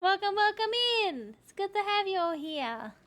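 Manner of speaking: high, loud bubbly greeting; cute anime streamer. Acted. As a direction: greet the raiders like an excited idol.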